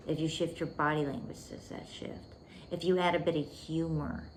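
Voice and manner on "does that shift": whispered question. Shown as a demonstration. Coach asking students if the energy shifts, whispered. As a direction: whisper it much softer and lower.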